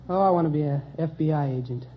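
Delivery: usual speaking voice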